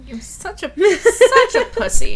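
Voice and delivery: high, loud, laughing taunt; laughing jeer. Real, not acted. Co-host mocking squeamish Carlo, laughing.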